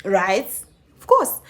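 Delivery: ordinary talk, nothing marked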